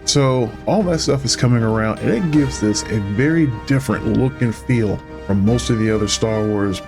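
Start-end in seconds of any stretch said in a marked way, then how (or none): none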